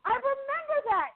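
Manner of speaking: very high-pitched, excited praise; retold gushing. Co-star praising the actor she just recognized, gushing. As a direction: gush in a mock-delighted high voice.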